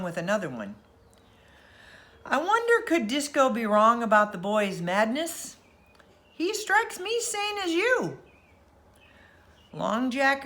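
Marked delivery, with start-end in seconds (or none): 2.2-8.2 higher, lightly voiced old sailor musing; a doubtful shipmate wondering aloud; lightly put-on salty fisherman; fisherman's voice. A narrator's reading.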